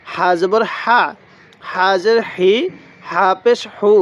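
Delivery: ordinary talk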